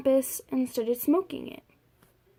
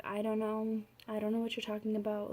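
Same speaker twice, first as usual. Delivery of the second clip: hushed, quick brush-off, much softer; quiet denial; 2 semitones lower, 9 dB quieter than usual. Retold or imitated. Girl quietly brushing off her brother's questions, hushed and fast. Lying to brother about texts.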